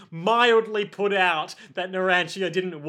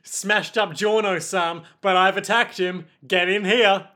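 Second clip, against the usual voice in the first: loud call to the others; yelling out; 4 dB louder than usual. Acted. Narantia yells for the team to come. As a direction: shout it across the room. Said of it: "allowing Narantia to yell out".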